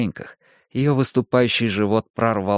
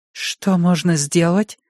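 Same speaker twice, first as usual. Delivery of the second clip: desperate plea; 8 semitones higher, slower than usual. A narrator's reading. Desperate parents ask doctor.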